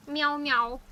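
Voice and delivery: mock meowing. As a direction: meow back at the cat like a kitten. Calls to the cat.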